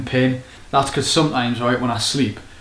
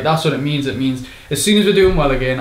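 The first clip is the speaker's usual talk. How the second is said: usual speaking voice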